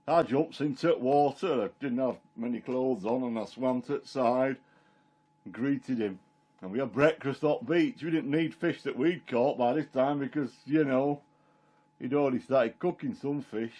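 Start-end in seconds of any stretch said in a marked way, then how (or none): none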